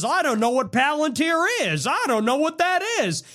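high, mock-innocent playing dumb; a light hint of feigned ignorance; mocking Vance. Mocking Vance denying knowledge on a podcast, high and faux-innocent. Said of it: "J D Vance goes on Theo Von and says".

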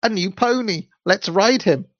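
put-on high eager call of a boy; excited cry. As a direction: call out, thrilled to spot a pony. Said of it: "cried Fred".

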